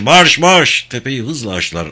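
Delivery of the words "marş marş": loud, high charge order; shouted command. A narrator's reading. Captain sending troops up the hill, shouting. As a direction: drive the men forward with a shout. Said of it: "diye bağırdı yüzbaşı".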